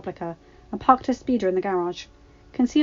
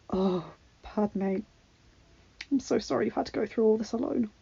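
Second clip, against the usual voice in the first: tender comfort; 2 semitones higher, 5 dB quieter than usual. A narrator's reading. Husband comforting grieving wife.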